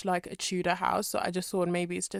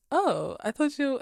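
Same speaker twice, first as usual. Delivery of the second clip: higher, louder, drawn-out "oh"; slow, pitched-up realisation; mock surprise; 7 semitones higher, 5 dB louder, slower than usual. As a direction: imitate a surprised interviewer. Acted interviewer's surprised reaction.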